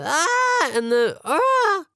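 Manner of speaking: high strangled screech, then squawk; strangled scream, bird noise. Retold or imitated. Sleepwalking brother explains soup thing, strangled screeches. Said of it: "strangled screaming noise and then bird noise".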